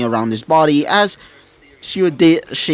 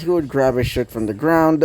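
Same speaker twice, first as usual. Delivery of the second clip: ordinary delivery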